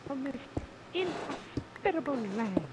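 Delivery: high, slow theatrical declaration; dramatic character voice. Pretend. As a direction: declaim like a stage hero.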